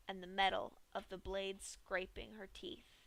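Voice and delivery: ordinary delivery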